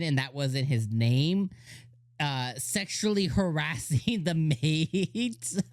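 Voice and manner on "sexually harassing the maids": real laugh creeping into drawn-out words; words stretched into a laugh; breaking into laughter. Real, not acted. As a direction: start cracking up as you say it. Cracking up at the scene retold.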